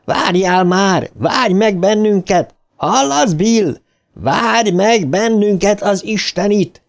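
high, exasperated yelling; sustained high-pitched angry calling; shouting. A narrator's reading. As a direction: holler after a runaway friend, drawing out the pleas. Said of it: "újra kiabálni kezdett".

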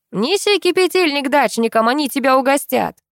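high-pitched call to a distant neighbour; calling across. A narrator's reading. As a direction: carry the words over the fence. Neighbour calls across the yards. (крикнуть соседу через забор)

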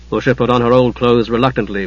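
ordinary delivery, nothing marked

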